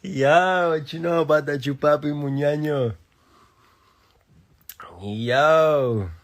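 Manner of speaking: high, loud excited outburst; hyped-up shout. Real, not acted. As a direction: yell it out, thrilled and amused. Hyped up over a catchphrase.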